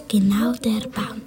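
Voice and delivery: plain reading voice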